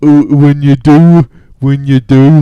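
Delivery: mock Cockney. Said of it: "a nineteen hundreds Cockney accent".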